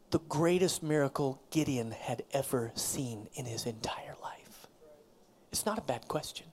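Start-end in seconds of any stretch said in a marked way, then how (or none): 0.1-4.7 whisper. Real, not acted. Preacher whispers of Gideon's coming miracle, hushed. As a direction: whisper it like a secret about to break. Building suspense before the miracle.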